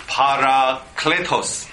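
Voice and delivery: "Parakletos" slowly, in drawn-out parts; syllable by syllable. Shown as a demonstration. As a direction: introduce "Para-kle-tos" slowly for learners to copy. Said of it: "pa四个音节".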